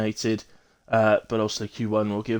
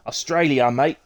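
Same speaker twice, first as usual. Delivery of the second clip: put-on a loose, hammy Australian accent on "Australia, mate", a touch louder; bad Australian accent; 6 dB louder than usual. Streamer announcing the race location to viewers, in a mock Australian accent. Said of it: "In my best Australian accent".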